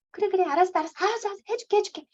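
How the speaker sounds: put-on high, coaxing mom placating a fussy kid; soothing a whiny child. Mom caving to a nagging child, high placating voice. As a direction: give in sweetly to stop the whining. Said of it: "어떻게 달래요, 우리?".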